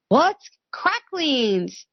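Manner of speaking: very slow, stretched "What's cracklings?"; drawn-out puzzled repeat. Real, not acted. Streamer reading a recipe to chat, asking slowly and baffled. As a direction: draw each word out, baffled.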